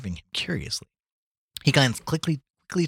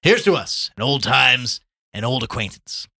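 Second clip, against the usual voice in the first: raised, hearty voice, easing down at the end; cheerful toast; 5 semitones higher, 8 dB louder than usual. A narrator's reading. Gallagher toasts old times with his friend, cheerful. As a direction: propose a toast with good cheer. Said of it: "said Ignatius Gallagher cheerfully".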